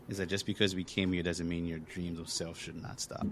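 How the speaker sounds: ordinary talk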